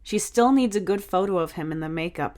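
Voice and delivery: usual speaking voice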